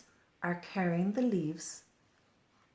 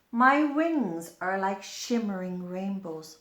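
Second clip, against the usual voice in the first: louder, a touch of a vain queen; proud dragonfly; 2 semitones higher, 8 dB louder than usual. Acted. Dragonfly boasting to the pond, louder and regal.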